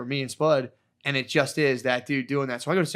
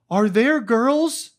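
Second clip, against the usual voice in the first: much higher, louder and very slow; mock spaced-out plea; imitating a dazed friend; 9 semitones higher, 7 dB louder, much slower than usual. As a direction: stretch the question out like someone floating. Tripping friend popping up asking.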